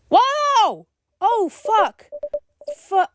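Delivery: very high startled cry; shocked shout. Real, not acted. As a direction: gasp it out in real shock. Sees the body on screen.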